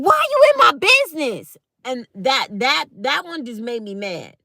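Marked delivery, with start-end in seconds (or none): 0.0-1.6 high, loud indignant snap; angry retort. Retold or imitated. Telling the nosy coworker to back off, loud and high.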